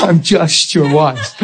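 slow, laughing imitation of the wife; laughing mock quote. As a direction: mimic her line while laughing. Mocking wife's line, laughing.

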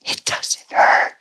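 retold in an awed whisper; hushed whisper. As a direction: breathe it out softly, amazed. Woman's retold words after healing.

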